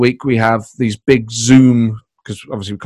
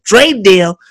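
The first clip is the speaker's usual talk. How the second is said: higher, louder incredulous Trump; 8 semitones higher, 4 dB louder than usual. Retold or imitated. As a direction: echo it like a baffled Trump. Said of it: "I would react to them as Trump's".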